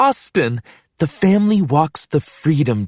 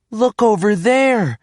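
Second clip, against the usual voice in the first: higher voice for Bob calling out; Bob's character voice; 8 semitones higher than usual. Acted. Bob showing the family Mount Rushmore, higher voice. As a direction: point it out eagerly as Bob.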